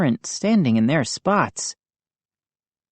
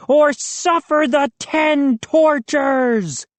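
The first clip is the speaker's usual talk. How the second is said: slow, higher hammed-up threat; mock threat; 11 semitones higher, slower than usual. A narrator's reading. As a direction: threaten like a cartoon bully. Bully threatens.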